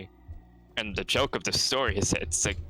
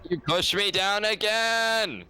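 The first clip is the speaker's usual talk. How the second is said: whiny drawn-out cry; 10 semitones higher, much slower than usual. Real, not acted. Game play complaint, drawn out.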